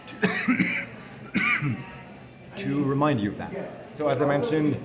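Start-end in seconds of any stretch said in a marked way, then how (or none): 0.0-1.8 high laugh breaking into a cough; giggling mid-sentence at own joke. Real, not acted.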